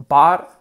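"bought" with an open A, like "baht"; A-like vowel. Shown as a demonstration. Teacher shows one extreme of "bought", open A. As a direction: stretch "bought" toward a loud open A. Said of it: "Puede ser un poco".

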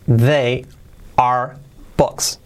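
word-by-word with long gaps; slow, isolated words for learners; slow model. Shown as a demonstration. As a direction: dictate each word separately. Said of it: "Dicho rápido".

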